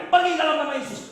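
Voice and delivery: high-pitched mock shout; retold exorcism command. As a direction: re-enact ordering a demon out. Re-enacted casting out of demon.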